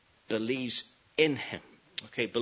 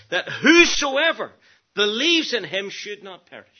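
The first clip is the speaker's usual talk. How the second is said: building to a loud, high proclamation; fervent preacher, voice climbing; impassioned preaching; 7 semitones higher, 7 dB louder than usual. Real, not acted. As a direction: drive the verse home from the pulpit. Preaching at full force.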